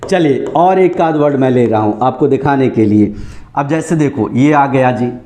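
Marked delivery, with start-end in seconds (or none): none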